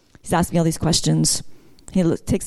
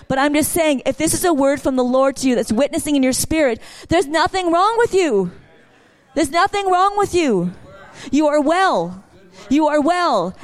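high-pitched, fervent preaching; preacher rising to full force; 6 semitones higher than usual. Acted. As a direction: proclaim healing over the crowd. Preaching healing to the congregation.

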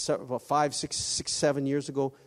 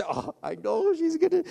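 laughing dread; 13 semitones higher, 5 dB louder than usual. Retold or imitated. Retelling her dread of mom.

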